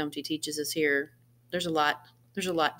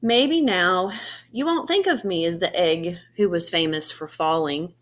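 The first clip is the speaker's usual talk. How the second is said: put-on expressive read-aloud to children, brighter and louder; animated picture-book narration with gentle pauses; storybook reading; 2 semitones higher, 5 dB louder, slower than usual. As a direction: read it like story time for a class. Reads picture book to students.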